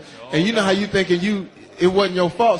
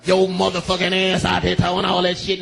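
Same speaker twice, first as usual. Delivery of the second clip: lightly voiced angry cop.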